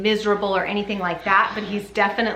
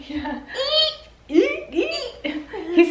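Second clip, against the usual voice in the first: laughing; 13 semitones higher than usual. Real, not acted. Laughing, talking to baby.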